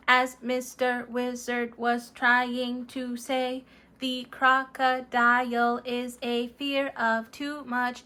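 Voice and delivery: flat, even robot monotone greeting friends; Mister Robot. Acted. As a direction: speak like a polite robot, no tune. Mister Robot gives the reading.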